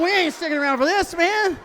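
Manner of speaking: high, quick mock protest; panicked creatures. Creatures fleeing the rider, hurried mock protest. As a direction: protest fast and high as you bail out.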